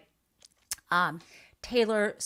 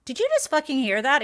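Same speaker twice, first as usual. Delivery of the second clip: high, loud outburst of disbelief; outraged; 8 semitones higher, 5 dB louder than usual. Real, not acted. Host reacting to the clip she just played, outraged shout. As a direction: let the outrage burst out. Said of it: "I'm swearing because I'm outraged".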